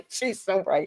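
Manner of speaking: words riding on a chuckle; laughing. Real, not acted. Co-host agreeing with the sitcom quote, laughing. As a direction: agree through a real laugh.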